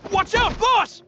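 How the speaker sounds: alarmed cry across the field; warning shout. Acted. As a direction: yell to save the boss. Warning the boss of attack.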